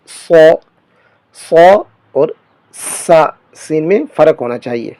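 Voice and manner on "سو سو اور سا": سو said thick and full with a whistle; full heavy saad. Shown as a demonstration. Teacher contrasting saad with seen, full heavy hiss. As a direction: fill the mouth and hiss the heavy s. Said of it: "تھوڑا سا پر کر کے پڑھیں گے".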